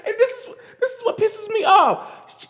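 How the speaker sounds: high laughing outrage; laughing while fed up; exasperated laugh. Real, not acted. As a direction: laugh through the annoyance.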